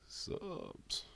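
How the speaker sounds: usual speaking voice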